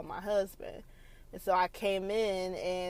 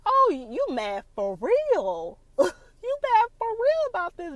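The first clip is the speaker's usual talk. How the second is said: high, louder teasing disbelief; mock-incredulous wife, higher and louder; mocking tease; 11 semitones higher, 7 dB louder than usual. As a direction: poke fun at his anger. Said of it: "I was like".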